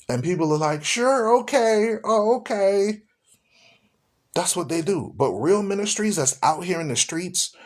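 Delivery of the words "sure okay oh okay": high-pitched mock agreement; gullible donors. Mimicking people who fall for the donation pitch, gullibly agreeable. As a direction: imitate an easily persuaded believer, pitched up.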